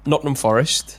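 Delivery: put-on Forest said in a London accent. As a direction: meant as Nottingham but comes out London. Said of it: "Why did Forest come out London?".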